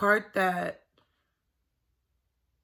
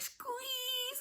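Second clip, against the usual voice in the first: put-on high, soft little kid voice; child's squeeze; 12 dB quieter than usual. Son Gabriel asking for a squeeze, high small voice. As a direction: squeak it like a four-year-old. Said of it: "he'd be like".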